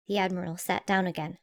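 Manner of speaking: ordinary delivery, nothing marked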